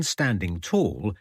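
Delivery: usual speaking voice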